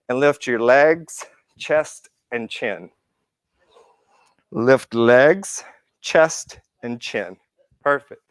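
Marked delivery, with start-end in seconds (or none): none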